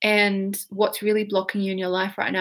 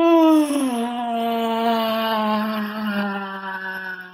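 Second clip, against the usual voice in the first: long open-jawed yawn sigh. Shown as a demonstration. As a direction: yawn it out wide. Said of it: "I want you to yawn".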